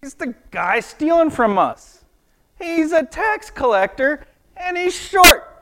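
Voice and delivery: grumbling townsfolk working up to a squeal; indignant crowd. Retold or imitated. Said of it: "everybody else is like".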